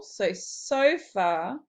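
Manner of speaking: ordinary delivery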